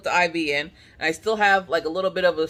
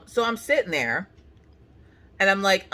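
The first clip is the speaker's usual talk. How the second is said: usual speaking voice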